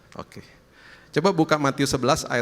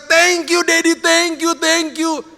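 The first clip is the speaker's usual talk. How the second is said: high, loud, gushing thanks; overjoyed child's thanks; 8 dB louder, much slower than usual. Retold or imitated. Child hugging Daddy after the painting sold, overjoyed. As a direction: imitate a thrilled child crying out thanks.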